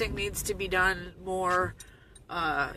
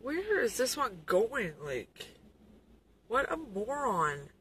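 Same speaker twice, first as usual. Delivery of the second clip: annoyed; 2 semitones higher, 4 dB quieter, slower than usual. Real, not acted. Annoyed at another driver.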